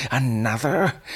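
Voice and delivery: "Another?" lifted high in disbelief. Acted. Old man's rising repeat.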